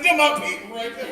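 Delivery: high, loud happy recognition; proud excitement. Retold or imitated. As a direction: crow it with pride, laughing. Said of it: "I said".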